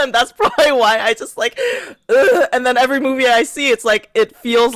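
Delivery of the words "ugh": short groan of exasperation, louder; disgusted groan. Retold or imitated. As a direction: groan at an actor you can't stand. Shows distaste for the actor.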